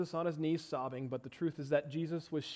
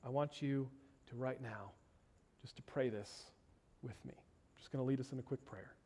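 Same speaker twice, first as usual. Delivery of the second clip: usual speaking voice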